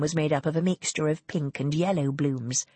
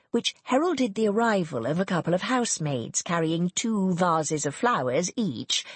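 ordinary delivery

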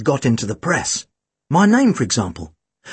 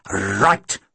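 grim policeman; 8 semitones lower than usual. A narrator's reading. Boyle grimly accepts the order.

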